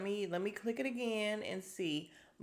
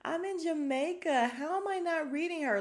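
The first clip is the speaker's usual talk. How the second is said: much higher, louder mock-whiny voice; mocking Sonya; 10 semitones higher, 5 dB louder than usual. Host mocking Sonya's confessional, higher, louder whiny voice. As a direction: make her sound clueless and indignant. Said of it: "Sonya's like".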